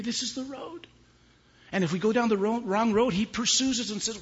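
high, loud, impassioned preaching; preacher proclaiming God's love; fiery preaching. Real, not acted. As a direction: preach it with full fire. Preaching at full force.

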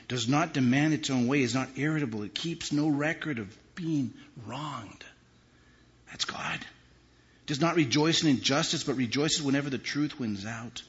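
loud, impassioned reading; preacher reading love verses; fervent scripture reading. Real, not acted. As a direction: proclaim the verses.

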